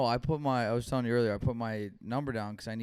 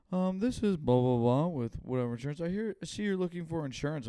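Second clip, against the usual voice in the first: mock salesy caller voice, higher and louder; insurance caller; 6 semitones higher, 8 dB louder than usual. Retelling spam calls to his cohost, mock pushy salesman, fast at the end. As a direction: ham up the pushy phone salesman.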